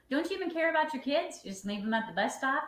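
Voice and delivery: hammed-up blaming partner about the kids; mock accusing partner. Shown as a demonstration. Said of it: "I didn't say".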